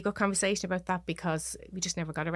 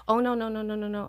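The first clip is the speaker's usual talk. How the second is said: raised, rapid-fire string of denial; retold sudden recoil, higher voice; alarmed refusal; 4 semitones higher, 5 dB louder, faster than usual. Retells recoiling at bedroom door.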